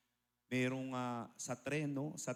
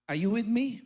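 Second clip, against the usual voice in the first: much louder, higher rallying shout; fiery preaching call; 8 semitones higher, 10 dB louder than usual. Real, not acted. Preacher rallying the congregation at full force, loud and high. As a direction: rouse the congregation.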